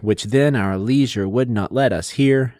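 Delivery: "which then our leisure would not let us hear" slowly, stressing every second syllable; exaggerated iambic stress. Shown as a demonstration. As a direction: lean on "then", "lei-", "not" and "hear" to show the meter. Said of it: "you can hear the stress, unstress".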